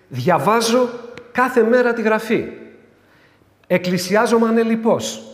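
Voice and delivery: high, loud, self-assured churchgoer; lightly voiced devout woman listing her virtues; a hint of a proud believer; pious woman. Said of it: "Αν μια γυναίκα έλεγε".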